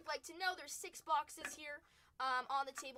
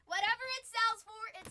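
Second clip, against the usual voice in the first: louder, higher repeat; emphatic callout; 8 semitones higher, 11 dB louder than usual. Real, not acted. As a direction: throw it out loud to the chat.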